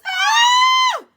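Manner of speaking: very high, loud scream; terrified scream. A narrator's reading. Wyn screaming in the smoke of the archway, terrified scream. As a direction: let out a piercing scream. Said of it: "Wyn screams".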